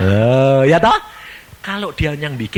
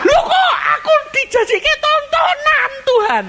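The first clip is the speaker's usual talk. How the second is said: high, whining, half-laughing protest; whiny laughing complaint; 4 dB louder than usual. Retold or imitated. Believer complains to God in a high, whining, half-laughing protest. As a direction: moan to God about being a spectacle.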